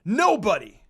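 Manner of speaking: loud, far-off voice shouting; loud yelled retort. Acted. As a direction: hurl the answer back.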